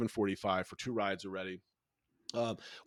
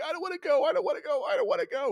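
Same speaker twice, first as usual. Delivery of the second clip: put-on much higher, whiny pleading child voice; imitating scared son; 4 dB louder, faster than usual. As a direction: mimic a terrified little boy begging not to ride. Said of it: "And he's like".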